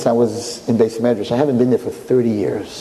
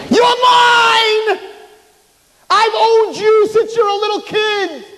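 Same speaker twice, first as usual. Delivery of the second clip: much higher, louder, far-off shriek; gloating Satan shout; 4 dB louder, slower than usual. Acted. Satan claims Chaim in a much higher triumphant shriek.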